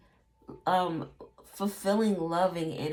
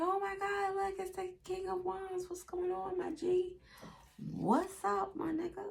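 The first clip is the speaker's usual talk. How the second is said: much higher, starstruck street greeting; hyped passerby; 11 semitones higher, 5 dB quieter than usual. Retold or imitated. A fan spotting the King of Wands on the street, high and excited. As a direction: squeal it like you spotted a celebrity.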